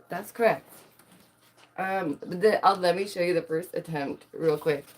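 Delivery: ordinary talk, nothing marked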